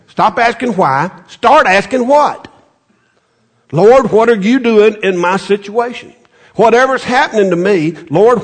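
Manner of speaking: rising sermon exhortation; full-force preaching. Acted. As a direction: preach it out with fire. Preaching climax.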